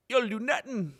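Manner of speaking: trash-talk it like an Irish fighter; Conor McGregor Irish accent. Retold or imitated. Imitating a fighter's taunt for chat, Irish accent. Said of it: "That's my best Conor McGregor impression".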